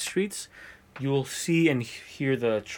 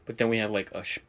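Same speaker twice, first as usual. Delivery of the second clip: ordinary talk, nothing marked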